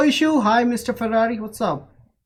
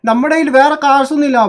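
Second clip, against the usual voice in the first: raised, high-pitched objection; loud protest; 9 semitones higher, 10 dB louder than usual. Real, not acted. Objecting to a friend about custom vehicles, loud protest. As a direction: push back loudly, voice jumping up.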